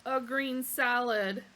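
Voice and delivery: slow, loud, every word pressed; emphatic. Acted. Arthur ordering firmly against the animal. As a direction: plant your feet and insist. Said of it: "said Arthur emphatically".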